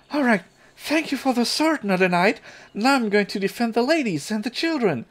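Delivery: put-on high-pitched eager kid, thrilled with a gift; high, eager little hero voice; excited young would-be knight. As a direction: pitch it way up as a child off to play knight.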